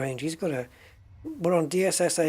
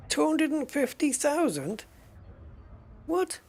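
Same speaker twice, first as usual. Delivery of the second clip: shocked outrage; 11 semitones higher than usual. Real, not acted. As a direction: pitch leaps high in disbelief. Shocked by the trader's price.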